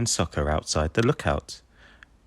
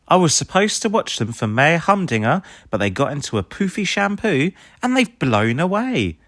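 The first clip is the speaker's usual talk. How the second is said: Rubble voice; 7 semitones higher than usual. A narrator's reading. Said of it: "Rubble tells Ryder".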